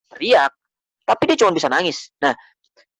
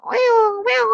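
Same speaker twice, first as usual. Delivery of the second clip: very high, imitated kitten mew; kitten meowing. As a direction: mew like a hungry little kitten. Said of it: "anak kucing tuh". (tiruan suara anak kucing)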